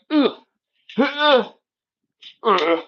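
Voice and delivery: lightly voiced groaning ogre; stiff ogre impression. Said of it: "that's my, like, stiff ogre impression".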